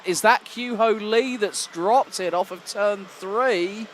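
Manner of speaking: ordinary talk, nothing marked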